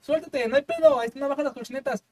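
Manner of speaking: pushy crew member. Retold or imitated. Crew urging her to let go.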